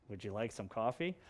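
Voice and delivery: quiet and low, like a threat; menacing. Shown as a demonstration. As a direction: ask it like a serial killer would. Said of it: "it can sound like a serial killer".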